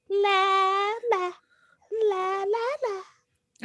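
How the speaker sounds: put-on high-pitched sung kid la-la; little kid voice. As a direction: sing it like a small child. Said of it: "Let me get my little kid voice".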